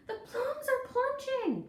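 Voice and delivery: loud, high panicked call; loud alarmed holler; booming cry. A narrator's reading. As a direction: shout the alarm across the raft. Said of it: "boomed my brother".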